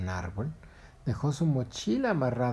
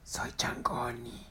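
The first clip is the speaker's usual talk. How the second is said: slow hushed whisper; 4 dB quieter, much slower than usual. Acted. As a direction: whisper the name softly and slowly. Monkey whispers to heron.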